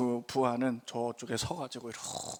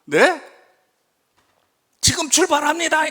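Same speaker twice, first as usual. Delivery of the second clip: much higher and louder, decisive; loud declaration; 12 semitones higher, 11 dB louder than usual. Retold or imitated. Boss shouting he's leaving now, loud and decisive. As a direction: announce departure at full voice.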